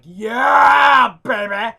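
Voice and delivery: loud, high whoop of joy; excited shout. Retold or imitated. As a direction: celebrate at the top of your voice. Friend offers a place.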